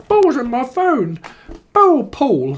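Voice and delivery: high, loud squawky voice; mock caller. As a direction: squawk it like a phone addict. Imitates phone addict.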